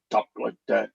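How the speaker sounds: ordinary talk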